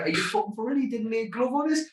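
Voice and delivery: imitating his outrage at the judges; outraged disbelief. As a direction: protest the decision, pitch climbing. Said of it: "I'm like".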